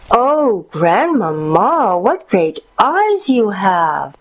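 slow, high swooping exclamation; mounting little-girl wonder, stretched words; crying out in amazement. A narrator's reading. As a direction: draw out the child's astonishment. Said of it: "the girl cried out".

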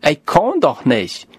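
ordinary talk, nothing marked

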